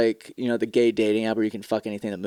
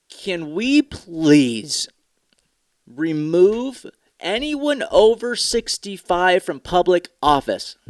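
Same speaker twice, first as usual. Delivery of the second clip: drawn out, slow, pleading higher; 5 semitones higher, much slower than usual. Real, not acted. Ranting to viewers, slowly pleading to remove old politicians. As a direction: plead each word slowly, in disbelief.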